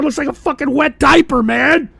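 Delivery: ordinary talk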